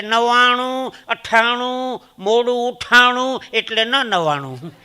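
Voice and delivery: loud, high sing-song number reciting. Retold or imitated. A man dictating his phone number in a sing-song voice. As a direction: chant the digits like a punchline. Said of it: "મને કે".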